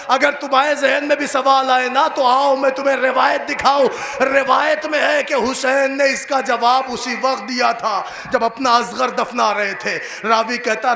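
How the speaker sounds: ordinary talk, nothing marked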